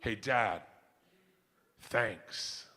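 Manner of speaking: low, soft, heartfelt thanks; tender, grateful. Retold or imitated. Dream daughter turning to dad on the boat, tender. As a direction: say it softly, with a pause before the thanks. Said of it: "dream scenario".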